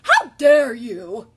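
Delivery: loud, slow, outraged turkey; gobbling turkey. A narrator's reading. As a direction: draw it out in gobbling indignation. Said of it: "he gobbled".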